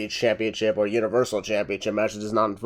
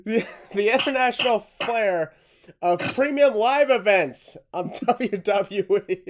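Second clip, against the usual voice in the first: higher voice breaking into laughter; slow, fighting off the giggles; laughing; 8 semitones higher, 5 dB louder than usual. Real, not acted. Retrying line while still laughing.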